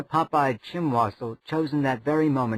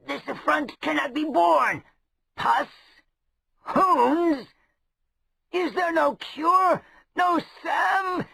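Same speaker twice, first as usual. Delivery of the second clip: much higher, shouting in horror; outraged rogue; slower than usual. Acted. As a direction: shriek as if the world is ending. Chimwazzle appalled at the symptoms.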